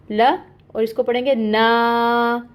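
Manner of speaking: ना with its आ drawn out long; two-beat madd. Shown as a demonstration. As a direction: slowly stretch ना. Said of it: "दो हरकत लंबा".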